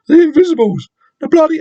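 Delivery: high, loud put-on voice; attempted Wigan accent. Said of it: "that's not how we talk".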